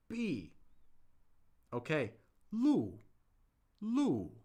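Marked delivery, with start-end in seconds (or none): 2.5-4.3 路 said with a sharp falling fourth tone. Shown as a demonstration. Teacher quizzing the chat, sharp fall on 路. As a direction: drop 路 hard from high. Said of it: "Which one is 路?".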